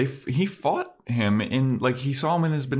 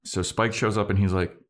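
ordinary delivery

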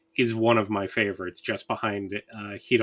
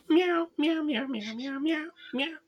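very high squeaky meowing; nervous cat voice; 13 semitones higher than usual. Retold or imitated. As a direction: mew like a tiny anxious cat. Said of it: "Ryoki doing her meow".

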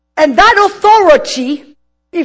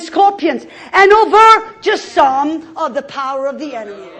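fiery preaching; 3 semitones higher, 4 dB louder than usual. Acted. Preaching scripture at full force.

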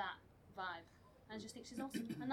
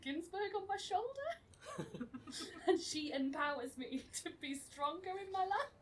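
laughing while talking; 9 semitones higher, 4 dB louder than usual. Real, not acted. Giggling at own story.

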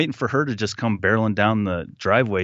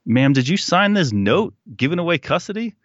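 stern cop; 9 semitones higher than usual. Retold or imitated. Cop questioning mom on phone.

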